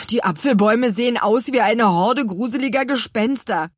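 a touch of creeped-out alarm, loudly; alarmed boy. A narrator's reading. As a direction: blurt it out emphatically. Louis blurts out his fear.

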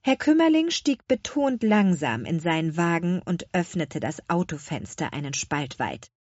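ordinary delivery

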